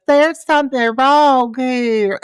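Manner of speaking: high, stretched-out southern wail; mock a wailing southern belle, drawing out the words; hammed-up southern drawl, wailed slowly; Gretchen's wailing southern drawl. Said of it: "she wails in her southern drawl".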